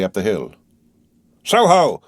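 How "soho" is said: high, sung-out shout; guard's bellowed hail; loud roar. A narrator's reading. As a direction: holler it as loud as you can. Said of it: "sang out, as loud as he could roar".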